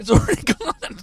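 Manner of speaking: laughing through the words; amused disbelief, giggling. Real, not acted. Laughing at friend's fast eating.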